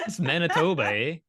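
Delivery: a light mock Canadian accent on eh. Teasing the co-host, a light mock Canadian accent. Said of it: "why they talked stupid like that".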